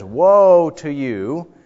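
a prophet pronouncing doom, slowly; slow condemning woe. Acted. Preacher voicing the prophet, slow threatening woe. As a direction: stretch it out like a threat. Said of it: "the prophet says".

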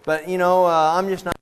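high, loud voice of a man with a grievance; aggrieved younger brother. Acted. Younger brother grumbles about his share of inheritance, aggrieved.

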